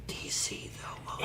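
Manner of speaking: hushed aside, barely voiced; whispered confession. Real, not acted. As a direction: whisper it like a guilty secret. Sheepish confession at Marvel talk.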